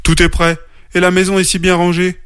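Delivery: high-pitched, amazed remark; astonished wonder. A narrator's reading. Father bear finding the house tidied overnight, astonished. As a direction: marvel at what you find. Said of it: "d'un air étonné".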